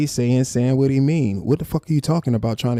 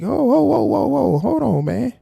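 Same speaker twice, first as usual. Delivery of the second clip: shrill whoa; 13 semitones higher than usual. Real, not acted. Streamer stops the guest's quote mid-sentence, in shrill disbelief. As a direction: cut it off in high disbelief. Cutting off his own quote.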